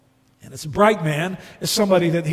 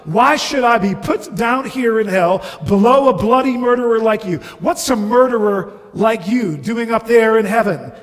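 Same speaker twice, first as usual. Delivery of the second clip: fast, loud, higher-pitched tirade; outraged ghost; 5 semitones higher, 6 dB louder than usual. Acted. Ghost resenting a murderer's place in heaven, outraged. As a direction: pour out the grievance indignantly.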